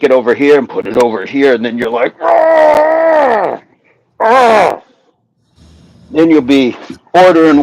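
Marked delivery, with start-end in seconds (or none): 2.2-4.8 high, straining "Ah!" cries; strained yell. Retold or imitated. Coach to class, acting out ab strain, high straining yelps. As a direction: let out two pained high yelps mid-crunch. Mimicking straining through a crunch.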